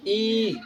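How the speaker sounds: ई drawn out long; held long vowel. Shown as a demonstration. Teacher giving long-vowel examples, ई drawn out. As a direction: hold ई as one steady vowel. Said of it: "अधिक समय लगता".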